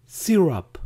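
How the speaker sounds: "Syrup" as SEE-rap; quiz option pronunciation. Shown as a demonstration. Teacher offers a choice for pancake syrup, "Syrup" as SEE-rap. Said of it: "¿cómo se pronuncia en inglés?".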